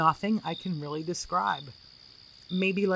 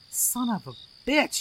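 sudden pained yell, higher; 7 semitones higher than usual. A narrator's reading. Friend burns his palm on the wall, yelling. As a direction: blurt it out as your hand burns. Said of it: "he yelled".

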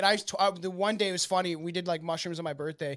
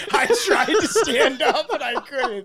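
high, loud, slow mock whine; mocking whiny voice; 8 semitones higher, 9 dB louder, slower than usual. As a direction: ham up a helpless guy straining. Mocking a man who can't walk.

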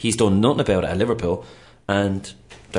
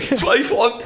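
squeaky laugh through the words; 14 semitones higher, slower than usual. Real, not acted. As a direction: laugh it out, high. Laughing reply.

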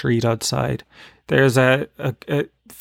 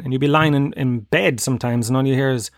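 ordinary delivery

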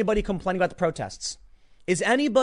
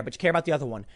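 ordinary talk

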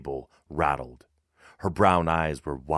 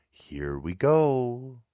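wry remark; 8 semitones higher, much slower than usual. Acted. Brother mutters wryly.

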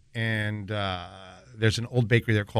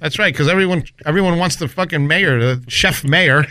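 sarcastic; 4 semitones higher, 6 dB louder than usual. Retold or imitated. Sarcastic reply to mayor.

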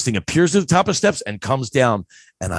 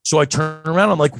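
ordinary talk

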